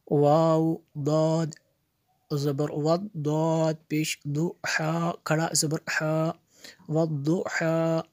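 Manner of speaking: measured Quran spelling drill; spelling-out recitation. Shown as a demonstration. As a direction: chant letters and vowel marks, then join them. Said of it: "जोड़ के साथ पढ़ेंगे". (हिज्जे जोड़कर लय में पढ़ना)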